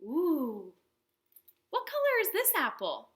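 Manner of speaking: ordinary delivery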